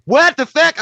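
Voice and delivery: loud, high mock outrage; outraged shriek. Girl hearing she was insulted, loud high mock outrage. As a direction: jump up high and loud in disbelief.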